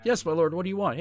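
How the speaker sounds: hammed-up high voice of an obedient follower; eager servant. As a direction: answer the lord high and eager. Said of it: "like, in that joke".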